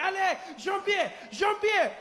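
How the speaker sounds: high, drawn-out calling to his son; panicked French dad. Acted. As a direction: yell for the boy while running. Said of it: "he's pushing the people and he's running".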